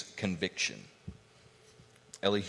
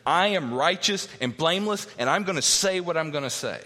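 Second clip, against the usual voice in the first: a touch of defiant self-defence in a higher voice; self-justifying Job; 6 semitones higher, 3 dB louder than usual. Acted. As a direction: insist on it, higher and emphatic. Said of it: "Job is justifying himself".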